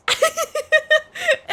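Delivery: bright burst of laughter; high laugh. Real, not acted. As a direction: laugh at your own joke. Laughs at own joke.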